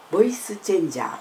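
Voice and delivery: usual speaking voice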